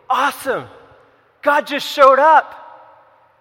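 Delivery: loud, high mock triumph; excited cheer. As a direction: exult loudly, drawing out the last words. Acts out the amazed onlooker's reaction.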